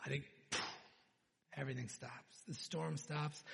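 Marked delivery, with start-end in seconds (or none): none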